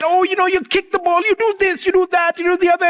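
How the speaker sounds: high, fast coaching from the stands; mock sideline yelling. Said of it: "and I'm yelling at him".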